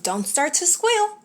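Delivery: lightly voiced pushy bug lecturing Horton; perky insistent bug scolding; bossy bug voice. A narrator's reading. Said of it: "said the Carabug".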